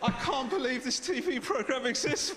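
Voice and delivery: ordinary delivery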